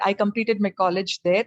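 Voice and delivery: normal talking voice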